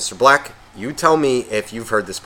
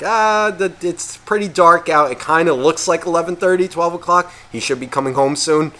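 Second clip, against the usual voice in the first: higher-pitched imagined dog musing; dog's thoughts; 4 semitones higher than usual. Acted. Waiting dog wonders when its owner gets home, musing higher. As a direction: voice a dog thinking aloud, easing down at the end. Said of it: "the way I picture a dog's head".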